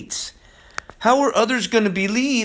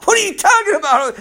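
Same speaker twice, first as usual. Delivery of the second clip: incredulous retort; 13 semitones higher, 4 dB louder, faster than usual. Retold or imitated. Preacher retorts to mocked people.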